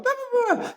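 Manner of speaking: very high mock squabble; imitated arguing noise. Father imitates partner arguing back, high mock babble. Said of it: "Blair's going".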